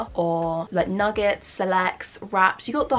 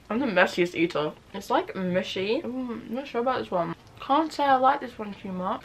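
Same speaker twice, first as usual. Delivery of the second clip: talking while chewing; 2 semitones higher than usual. Real, not acted. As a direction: mouth partly full of food. Seen 0: Bit wrap at -2; grimacing, covers mouth while talking and chewing.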